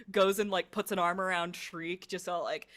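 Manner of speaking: usual speaking voice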